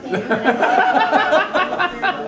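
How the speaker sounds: high laugh. Real, not acted. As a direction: burst out laughing. Laughs at story.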